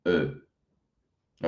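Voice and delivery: "E" said with lips closed and rounded; rounded E, lips pushed forward; French E. Shown as a demonstration. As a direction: hold an O mouth and say "E". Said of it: "Tenho que falar E com a boca assim".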